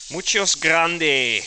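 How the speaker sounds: slow, loud mock Spanish; drawn out like a joking tourist. Streamer joking about the big dishwasher in mock Spanish, slow and loud.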